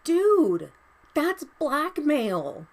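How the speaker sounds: high, loud "Dude", then slow "that's blackmail"; stunned outrage, drawn out; outraged disbelief. Real, not acted. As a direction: call it out in shock. Outraged reaction to a quote.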